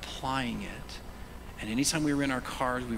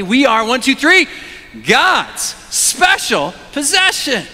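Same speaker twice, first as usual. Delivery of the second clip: shouted much higher and louder, slow on the phrase; with gusto; 13 semitones higher, 16 dB louder, slower than usual. Shown as a demonstration. Pastor leading the church to shout the verse. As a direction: count in and shout it out together. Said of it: "say it with a lot of gusto".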